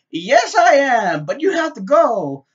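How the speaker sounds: loud, high, slow and drawn out; mock over-eager insistence. Sheila telling her friend to leave, over-eager and loud. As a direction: stretch it out loudly, pitch up.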